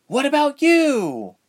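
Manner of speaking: loud, very high exasperated cry. A narrator's reading. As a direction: cry out in protest, stretched and high. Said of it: "Ilse cried, throwing her hands".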